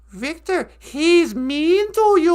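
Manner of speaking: mock overacted line, drawn out; Thomas Builds a Fire's grandma. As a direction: stretch it out like a terrible actress. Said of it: "her acting is just, it's off the charts terrible".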